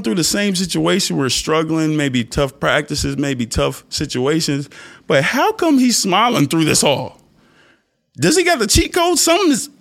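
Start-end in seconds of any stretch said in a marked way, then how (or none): 5.1-9.7 high-pitched mock disbelief; incredulous questioning in a raised voice; baffled onlooker. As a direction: imitate a puzzled bystander, pitched way up. Voices puzzled onlookers questioning him.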